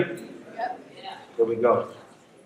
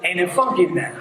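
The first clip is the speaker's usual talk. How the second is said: usual speaking voice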